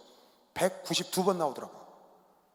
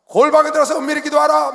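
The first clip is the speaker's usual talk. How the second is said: 골방에 stressed, higher and louder; scripture quoted with emphasis; 7 semitones higher, 11 dB louder than usual. Shown as a demonstration. Pastor reading the verse aloud to the congregation, emphatic. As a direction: proclaim the verse. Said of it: "여러분 잘 봐야 합니다".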